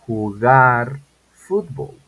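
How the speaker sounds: each word drawn out slowly and loudly; slow model sentence. Shown as a demonstration. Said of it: "Como digo".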